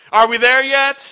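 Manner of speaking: put-on a bored child begging the parents; whiny child. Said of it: "what's the question that they always ask?".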